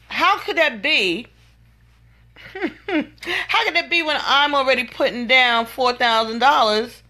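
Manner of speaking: incredulous. Real, not acted. Outraged at the contract numbers.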